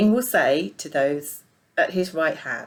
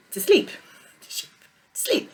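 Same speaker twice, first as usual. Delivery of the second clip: laughing at own slip; 13 semitones higher than usual. Real, not acted.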